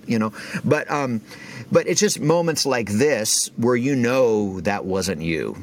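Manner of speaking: ordinary talk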